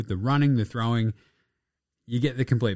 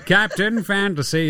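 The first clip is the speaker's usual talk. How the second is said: segment title call; 9 semitones higher, 4 dB louder, slower than usual. Acted. Announcing segment title again.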